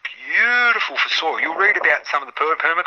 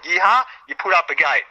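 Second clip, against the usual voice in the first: ordinary talk, nothing marked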